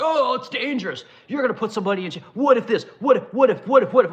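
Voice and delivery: high, whiny mock voice repeating what if; hammed-up nagging scold; mocking worrier. Mocking the safety crowd in a high whiny voice. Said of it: "everyone races like".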